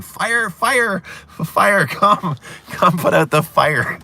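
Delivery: high, loud mock yell of fire; retold screaming. Kid yelling for the adults to put out the coop fire, high loud mock scream. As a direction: shout fire as a panicked kid. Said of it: "and I started screaming".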